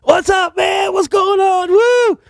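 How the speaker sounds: high, shouted party greeting; whooping rock-star hello; excited greeting. Retold or imitated. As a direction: yell it like a rowdy bandmate. Band greeting him loudly.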